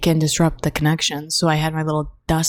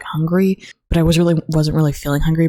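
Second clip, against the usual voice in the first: usual speaking voice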